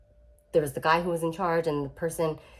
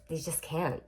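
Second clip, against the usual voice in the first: ordinary delivery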